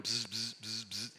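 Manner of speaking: short, soft bee buzzes; imitated bee buzz. Bee signalling distance to the hive, imitated buzzing. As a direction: buzz like a dancing bee in little bursts. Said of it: "the frequency of the buzzes".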